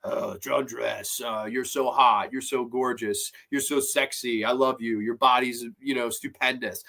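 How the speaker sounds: disguise your voice as a leering caller; fake stalker voice. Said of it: "she's putting on this fake voice".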